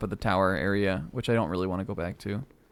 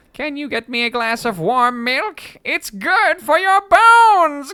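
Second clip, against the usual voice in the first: high, loud goofy skeleton; slow and silly, like a chill skeleton; 9 dB louder, slower than usual. Acted. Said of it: "I think he was chilling".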